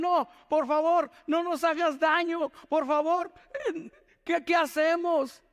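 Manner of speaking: mildly panicked pleading; frightened brothers. Acted. Brothers plead with José, mock fear.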